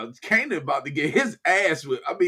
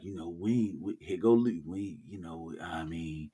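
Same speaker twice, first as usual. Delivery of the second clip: timid hesitant quote; 11 semitones lower, 6 dB quieter than usual. Retold or imitated. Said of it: "they was like".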